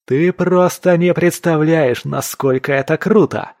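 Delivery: high, loud and excited; thrilled gushing. A narrator's reading. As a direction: gush with elation to your friend. Ilya elated about his triumph.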